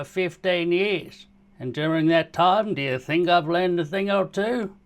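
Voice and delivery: put-on sleazy old geezer luring a kid; old man voice. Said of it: "how good my old man voice".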